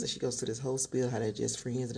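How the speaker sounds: usual speaking voice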